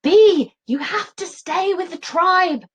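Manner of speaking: high, loud call; Dad calls her back. Acted. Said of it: "calls Dad".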